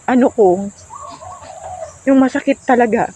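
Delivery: shaky, tearful, just stopped crying; after crying. Real, not acted. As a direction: voice unsteady from tears, trembling. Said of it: "Sorry at umiyak ako".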